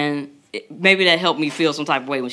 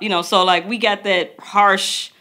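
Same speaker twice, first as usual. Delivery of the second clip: ordinary talk, nothing marked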